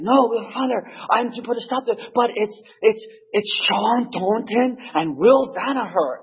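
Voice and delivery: high, pleading, slowing on the names; insistent young priest. Retold or imitated. Young priest insisting to the old priest, high and pleading. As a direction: stress who is fighting. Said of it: "He's like".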